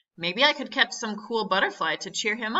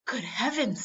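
higher, hushed amazement; gasped astonishment; 4 semitones higher than usual. A narrator's reading. Uncle spotting something over Jeff, gasping in wonder. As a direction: gasp it in mild awe. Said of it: "he gasped".

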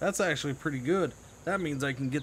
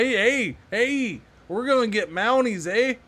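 mock Canadian accent; 6 semitones higher, 4 dB louder than usual. Mock Canadian accent about Mounties.

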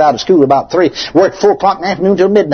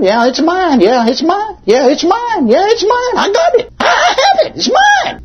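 mock sick people claiming it, climbing steadily higher in pitch. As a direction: keep repeating it, rising higher with each line, until it turns into a laugh. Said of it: "they tell people".

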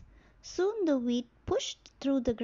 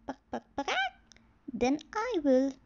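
clucking hen; 4 semitones higher than usual. Acted.

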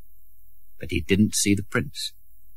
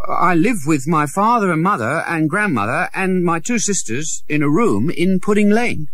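put-on higher boy's voice, plain and earnest; a poor boy telling the prince where he lives; beggar boy Tom; 8 semitones higher, 3 dB louder than usual. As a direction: speak simply as a poor boy. Tom's voice.

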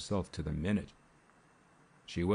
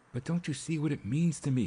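a pained outburst, pitched up; bursting out; 8 semitones higher than usual. A narrator's reading. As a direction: voice jumps high in protest. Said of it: "he burst forth".

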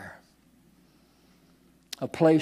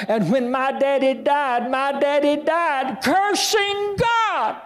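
higher, slow, preaching at full force; preaching climax; 11 semitones higher than usual. Acted. Preacher telling of a man's father, high, slow preaching. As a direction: drive the point home from the pulpit.

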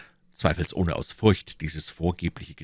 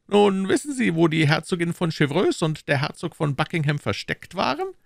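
higher, pressing questions as the Cardinal; Cardinal questioning; 12 semitones higher than usual. Acted. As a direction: interrogate his agent coolly. (der Kardinal, verhörend)

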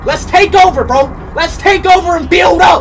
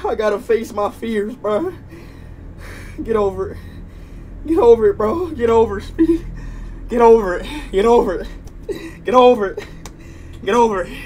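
quiet self-talk; 9 semitones lower, 12 dB quieter than usual. Real, not acted. Psyching himself up quietly.

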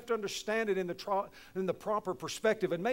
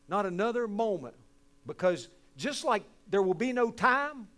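ordinary talk, nothing marked

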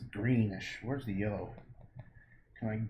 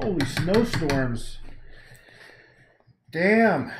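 surprised; 6 semitones higher, 10 dB louder, much slower than usual. Real, not acted. Sees heavy snow outside.

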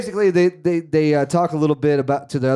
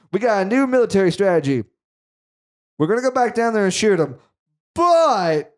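lightly imitated officer, pitched higher; mock military commander; 6 semitones higher than usual. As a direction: announce the plan like a pretend commander. Acts the commander announcing a strategy.

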